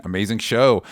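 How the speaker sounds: ordinary talk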